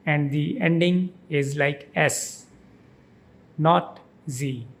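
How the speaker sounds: ordinary delivery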